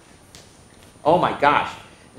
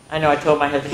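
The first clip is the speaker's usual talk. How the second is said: ordinary delivery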